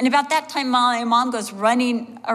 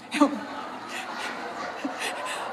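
airy unvoiced laugh; breathy laugh; 9 dB quieter than usual. Real, not acted. Pastor laughing at her mother's story, breathy laugh.

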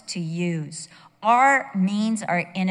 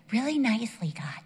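ordinary delivery

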